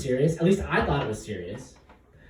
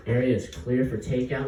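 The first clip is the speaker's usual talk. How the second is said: ordinary delivery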